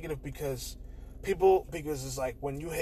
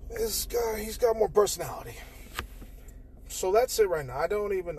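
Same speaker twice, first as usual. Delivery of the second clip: ordinary delivery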